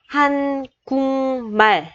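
한국말 said as han-gung-mal, the k turned to ng; 한국말 with a nasal ng, han-gung-mal; pronunciation demo. Shown as a demonstration. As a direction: say 한국말 with ng before the m. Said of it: "khi đọc mình sẽ chuyển thành chữ Ng".

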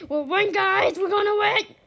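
high, loud cheer; rally the team with confidence; excited rallying. Real, not acted. Player telling guys they will win, loud excited cheer.

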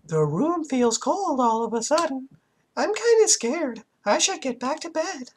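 put-on high, frightened little girl; scared girl voice. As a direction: whimper it as a scared child.

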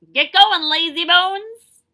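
loud, high yell with a slow stretched end. Acted. Sister yelling at Trudy to hurry up, loud shout. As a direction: bellow it at a slow swimmer. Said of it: "her sister Margaret yelled".

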